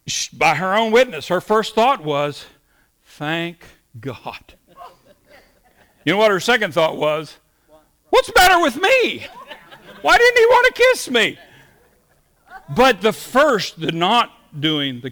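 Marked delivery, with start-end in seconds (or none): 3.0-4.5 low, soft, drawn-back mock relief; private relief. Woman left at the door without a kiss, quietly relieved. Date's first thought at the door. 8.1-11.4 high, loud, hammed-up female wail; mock-indignant woman. Date left unkissed at her door, loudly mock-indignant in high voice. Date's indignant second thought, acted out.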